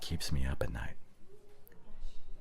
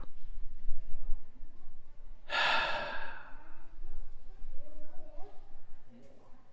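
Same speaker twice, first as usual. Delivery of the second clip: long sigh. Acted. Pause in the argument.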